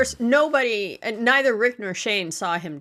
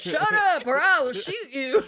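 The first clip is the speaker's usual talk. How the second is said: phone ringtone shout; 6 semitones higher than usual. Retold or imitated. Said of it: "all of a sudden the phone goes".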